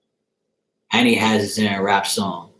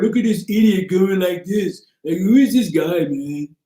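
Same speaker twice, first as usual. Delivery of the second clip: higher, put-on imitation of the streamer's voice; imitating Blue Lion; 6 semitones higher, 3 dB louder, slower than usual. Retelling how the wannabe psychic streamer talks, mocking imitation. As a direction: mimic the streamer's way of talking, mocking. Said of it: "That's how he talks in real life".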